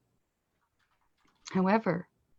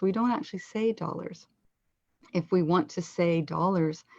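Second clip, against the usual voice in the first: normal talking voice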